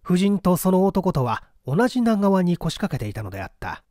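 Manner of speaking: usual speaking voice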